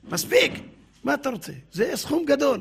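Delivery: high-pitched acted protest; exasperated haggling. Rich man exasperated while haggling.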